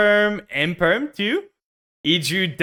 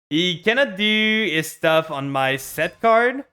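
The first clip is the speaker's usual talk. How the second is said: normal talking voice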